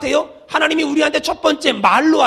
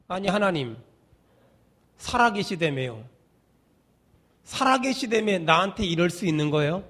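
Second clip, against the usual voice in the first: low, quiet mock grumbling to God; aggrieved complaint; 7 semitones lower, 8 dB quieter than usual. Believer under discipline reproaching God, low aggrieved grumble. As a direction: mutter a reproach, with long pauses.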